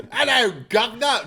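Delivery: high, loud hammed-up Cockney greeting; Cockney accent. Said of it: "I wanna hear your Cockney".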